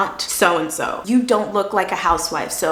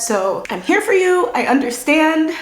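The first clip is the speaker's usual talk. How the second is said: reassuring; 7 semitones higher than usual. Real, not acted. Comforting viewers.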